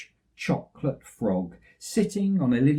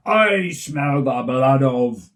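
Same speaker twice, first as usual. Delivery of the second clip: giant voice; 7 dB louder, much slower than usual. Acted. Giant hunting for the bears, booming slow. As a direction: roar it out like a huge giant.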